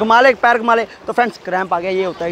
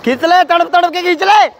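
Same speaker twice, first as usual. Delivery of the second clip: urging on; 8 semitones higher than usual. Real, not acted. Urging Riddhika to pull hard, shouted high. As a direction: drive her through the last stretch.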